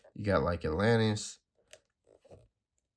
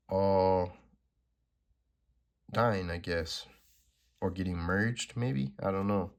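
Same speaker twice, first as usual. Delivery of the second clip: normal talking voice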